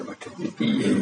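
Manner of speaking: breathy whispered dismay, half-laughing; worried whisper. Acted. Mungkar Nakir whispering in alarm to each other after Umar's boast. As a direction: mutter it to yourself like a cornered angel.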